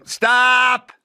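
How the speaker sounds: barked stop, high and loud; sharp stop. Retold or imitated. Teacher stopping a scene, sharp loud call. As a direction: cut the student off sharply. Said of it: "And then she'd go".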